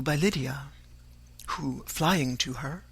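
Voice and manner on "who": usual speaking voice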